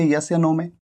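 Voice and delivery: ordinary delivery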